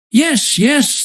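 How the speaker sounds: high, eager reply; a touch of eager pirates. A narrator's reading. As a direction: blurt it out keenly. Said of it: "they answered eagerly".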